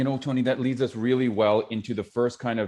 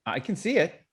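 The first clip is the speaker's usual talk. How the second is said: heated repetition exercise; 4 semitones higher than usual. Shown as a demonstration. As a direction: push back, let it escalate. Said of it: "let's do one right now".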